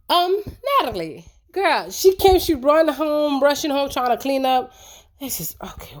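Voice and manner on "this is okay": whispered aside. Real, not acted. Catches herself off topic.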